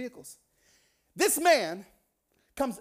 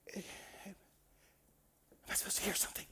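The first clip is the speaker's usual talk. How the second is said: uncomfortable whisper; 15 dB quieter than usual. Retold or imitated. Uncomfortable friend after thirty silent seconds, whispering.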